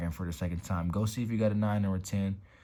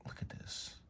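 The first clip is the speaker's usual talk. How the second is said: awed whisper; 2 semitones higher, 16 dB quieter than usual. Real, not acted. Admiring card in awe.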